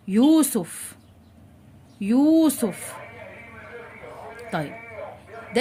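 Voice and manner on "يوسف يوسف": "يوسف" with a distinct y and lengthened first vowel; very clear ya. Shown as a demonstration. Teacher modelling a ya with damma, carefully enunciated. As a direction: draw out the start of "يوسف" for learners. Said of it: "ولازم انطق الياء بشكل واضح جدا".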